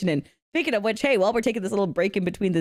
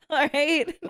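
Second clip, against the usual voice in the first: ordinary talk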